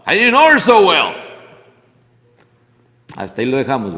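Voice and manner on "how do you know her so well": mock indignant challenge. Preacher retorts to the Pharisee.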